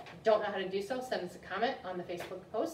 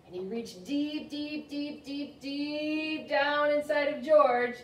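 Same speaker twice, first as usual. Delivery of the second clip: slow, sung-out high deeps, swelling louder; suspenseful build; 7 semitones higher, 8 dB louder, much slower than usual. A narrator's reading. Librarian telling how far the vet reached, mounting suspense.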